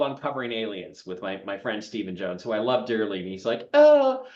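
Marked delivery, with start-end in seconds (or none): 3.7-4.3 high, exaggerated British accent. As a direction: put on a lofty British voice, pitched way up. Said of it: "in his British accent".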